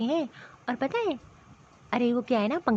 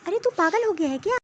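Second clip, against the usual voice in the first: ordinary talk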